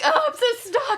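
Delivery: high, laughing fake distress; laughing mock whine.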